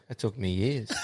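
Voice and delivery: soft, crushed mock lament; mock shattered dad. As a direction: play the heartbroken gardener, quietly. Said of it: "the old boy was shattered".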